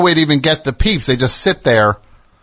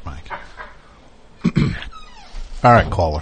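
normal talking voice

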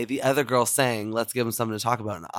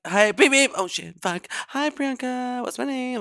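high mock voicemail voice, much higher; imitating a chaotic caller; 11 semitones higher than usual. Host mimics caller's chaotic voicemail, high and mocking. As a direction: playfully mimic the caller fumbling. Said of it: "You're like".

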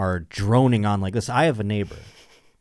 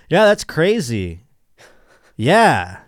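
slow, loud, mock-impressed yeah; fake interest; 4 semitones higher, 6 dB louder, much slower than usual. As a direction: pretend to be amazed to humor her. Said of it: "and then you're like".

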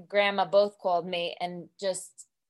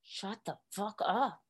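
muttered rage; 7 dB quieter than usual. Retold or imitated. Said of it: "rage of, it's like hot lava".

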